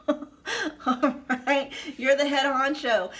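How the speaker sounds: giddy laughing repeat. Real, not acted. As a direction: blurt it out laughing with delight.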